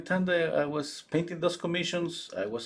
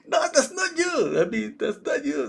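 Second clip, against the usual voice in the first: much higher, louder, insistent no; shrill denial; 4 dB louder than usual. Retold or imitated. Mother denies again, heated and shrill. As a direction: refuse to let it go.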